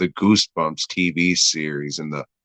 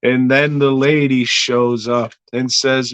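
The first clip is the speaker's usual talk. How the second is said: ordinary talk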